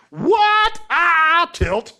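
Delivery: high, loud startled cry; mock shock. Guards learning Paul is Roman, high mock shock. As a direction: yelp it like caught off guard.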